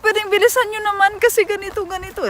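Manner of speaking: very high crying plea in Tagalog; tearful whine. Retold or imitated. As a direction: wail it, on the edge of tears. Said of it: "I really speak like that".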